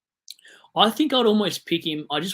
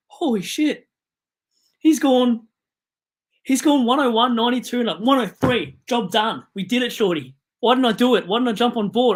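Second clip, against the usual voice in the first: higher, louder thrilled rant; excited; 6 semitones higher, 6 dB louder than usual. Real, not acted. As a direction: celebrate the pick scoring big. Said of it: "I think I'm getting excited".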